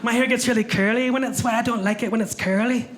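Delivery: high Scottish woman's voice; lilting Scottish visitor voice; Scottish accent. Retold or imitated. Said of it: "the first thing she said was".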